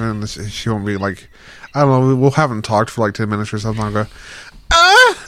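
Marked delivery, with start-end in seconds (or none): 4.7-5.3 sudden high, loud moan; mock moan. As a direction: burst out with an exaggerated moan. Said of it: "you moan really loud".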